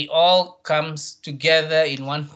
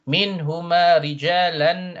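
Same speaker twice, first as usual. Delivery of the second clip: slow, drawn-out chant; measured Quranic model reading; tajweed recitation; 2 semitones higher, 4 dB louder, slower than usual. Shown as a demonstration. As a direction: recite slowly, sustaining each long vowel. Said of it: "the lam you can see is staggered".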